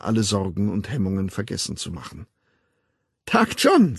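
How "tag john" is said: high, bright-pitched amused hello; lilting, louder, merry hello; laughing greeting. A narrator's reading. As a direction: greet him as if about to laugh. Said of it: "sagte sie lachend".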